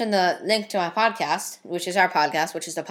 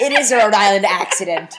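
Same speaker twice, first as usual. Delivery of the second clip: laughing through it, a bit louder; laughing tease; 6 dB louder than usual. Real, not acted. Son laughing as he teases his mother's slip. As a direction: giggle while mocking the slip.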